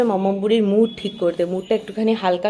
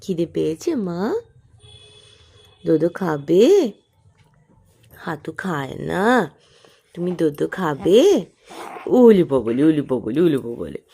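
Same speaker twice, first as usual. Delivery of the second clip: put-on baby talk; 4 semitones lower than usual. Mother cooing to her hungry baby before feeding. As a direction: murmur tenderly to the hungry baby.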